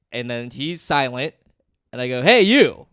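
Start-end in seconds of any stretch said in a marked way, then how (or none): 2.2-2.9 loud, high "hey you" to grab attention; calling out. Retold or imitated. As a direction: shout across a room at someone ignoring you. Calling out to silent audience member.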